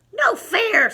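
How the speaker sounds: high, squeaky complaint; squeaky protest. Acted. Mouse squeaking a protest at Bear, high and loud. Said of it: "squeaked Mouse".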